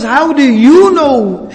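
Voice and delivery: high, loud and slow, stunned; shocked disbelief. Acted. Stunned stranger asks the Prophet, high shocked disbelief. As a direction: gasp it out in utter shock. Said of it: "he's so shocked".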